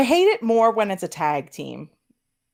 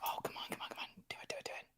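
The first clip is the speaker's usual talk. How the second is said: urgent whisper, voiceless; 24 dB quieter, much faster than usual. Real, not acted. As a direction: whisper it, willing it to happen. Urging wrestler under breath.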